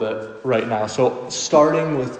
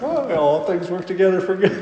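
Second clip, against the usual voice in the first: mock cheery platitude; 6 semitones higher than usual.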